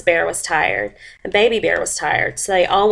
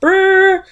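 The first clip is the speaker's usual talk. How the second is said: short high, loud trill; quick burst of buzzing alarm; alarm clock ring; 13 semitones higher, 6 dB louder than usual. A narrator's reading. Alarm clock goes again, loud trilling. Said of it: "went the alarm clock".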